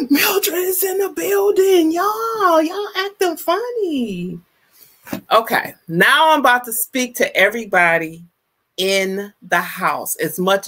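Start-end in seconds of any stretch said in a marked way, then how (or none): 0.1-4.4 high, gleeful call-out; thrilled hype for a new arrival; excited announcement. Real, not acted. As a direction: announce her like a celebrity. Greets a viewer who just arrived.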